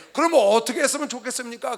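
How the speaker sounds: urgent, projected appeal; people asking God. Acted. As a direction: cry out for guidance as a repentant people. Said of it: "깨닫고 이스라엘 백성들이".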